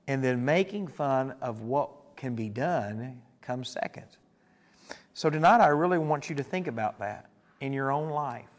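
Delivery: usual speaking voice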